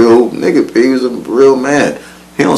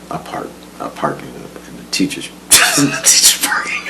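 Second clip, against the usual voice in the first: giggling whisper; 6 semitones higher, 10 dB quieter than usual. Real, not acted.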